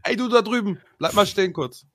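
high call over a crowd; yelling across the room. Pretend. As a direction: call out to someone far away. Calling someone across the hall.

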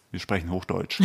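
put-on mother hushing a dialect-speaking kid into proper German; imitating correcting mother. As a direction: parrot the mother's correction softly and primly. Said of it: "die meinte so".

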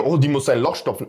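ordinary delivery

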